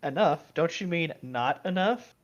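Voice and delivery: usual speaking voice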